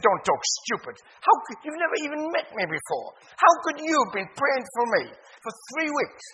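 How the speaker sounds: loud, scoffing disbelief; Billy scoffs at the preacher, loud and indignant; indignant retort. Retold or imitated.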